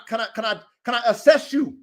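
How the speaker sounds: ordinary talk, nothing marked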